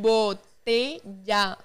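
slow, separated syllables; slow, measured dictation; word by word. Real, not acted. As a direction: break it up slowly for someone reading lips. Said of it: "dile palabra por palabra".

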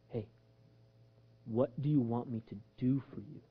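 low, hushed, unhurried asking; gentle question. Acted. Jesus asking the blind man his need, gently. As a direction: ask very softly, with pauses.

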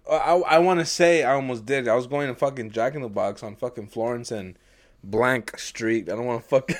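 usual speaking voice

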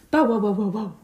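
loud big-dog bark; loud bow-wow dog. Acted. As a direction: bark it out like a hound.

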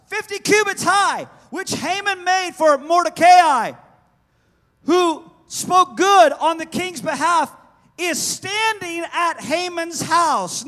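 high, very loud, stretched-out proclamation; sustained shout. Acted. Eunuch pointing the king to the gallows, sustained shout. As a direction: shout every phrase like it ends in an exclamation point. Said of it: "Let's do it again".

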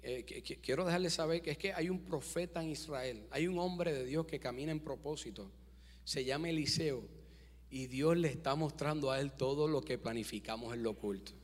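soft, timid servant reporting to the king; hesitant servant. Acted. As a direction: tell the king nervously, in a low voice. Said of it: "y dice". (siervo tímido, en voz baja)

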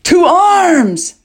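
loud, high rallying shout; alarmed cry. A narrator's reading. King sees a dragon behind the army, crying out. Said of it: "cried King Wara, his eyes wide with terror".

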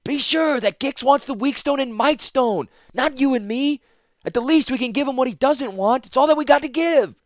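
high, loud anxious woman; Zancha's voice. Acted. Zancha answering Ratapi, high, loud and anxious. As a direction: argue it through fear.

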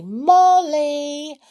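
high, loud calling out across the street; shouting for Molly and Binky through the village; shouting names. A narrator's reading. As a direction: call out for lost friends, loud and high. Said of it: "he shouted loudly".

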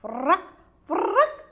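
loud frog croak, rising high. A narrator's reading. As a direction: shout it like noisy frogs. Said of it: "diye bağırdı çizgili kurbağalar".

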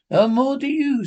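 high, loud snap back; sharp loud retort. Acted. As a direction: fire it back loudly.

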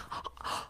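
short sharp gasp of surprise; startled gasp. Acted. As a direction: catch your breath at the sight. Servant spots the beggar.

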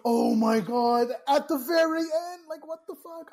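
loud, high-pitched disbelief; appalled fan exclaiming; exasperated outburst. Real, not acted. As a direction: burst out in real exasperation, stretching the opening words. Exclaims over the movie's ending.

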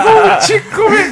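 high, drawn-out mock shout; catchphrase character voice. Co-host doing Majin Buu on request, high drawn-out character voice. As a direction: squeal it slowly, playing a hungry cartoon monster. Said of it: "Como é que ele faz, Carneiro?".

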